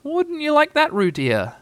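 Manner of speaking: put-on high, singsong question to a child; motherly teasing lilt; coaxing mother. A narrator's reading. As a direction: coax a little one sweetly, pitched high. Kanga coaxes pretend Roo.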